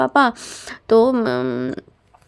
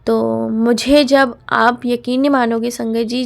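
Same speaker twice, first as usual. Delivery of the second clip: usual speaking voice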